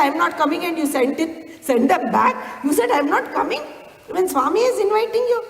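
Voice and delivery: high-pitched retold telling-off; scolding. Swami scolding the lady who sent the boys back, indignant. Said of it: "I didn't even register that he was scolding me".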